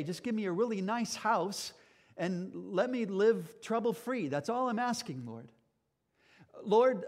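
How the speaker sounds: preacher voicing the blind man, loud and pleading for riches; blind beggar's plea. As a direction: play the beggar asking big, half joking.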